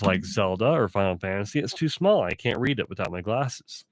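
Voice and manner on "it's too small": ordinary talk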